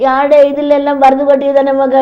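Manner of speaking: ordinary delivery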